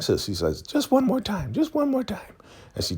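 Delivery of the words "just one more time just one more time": high falsetto insistence; mock coaxing. Zane coaxing Elder Mother for one more, high mock voice. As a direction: imitate a squeaky voice coaxing.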